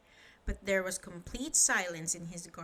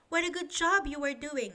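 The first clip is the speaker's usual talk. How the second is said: higher, eager chatterbox voice; chatty Mister Chatterbox; 6 semitones higher, 4 dB louder, faster than usual. Acted. Chatterbox praising the window cleaner, high eager gush. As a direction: gush at him cheerfully. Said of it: "he said to the window cleaner".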